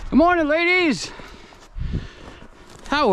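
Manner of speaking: high sing-song hello to the hens; chirpy high greeting. Acted.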